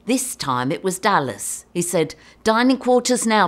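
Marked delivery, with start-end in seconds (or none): 2.4-3.5 louder, higher brisk order; raised voice, commanding; intercom summons. Retold or imitated. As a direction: imitate a captain calling crew over the intercom. Captain's order over intercom.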